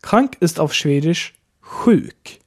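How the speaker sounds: normal talking voice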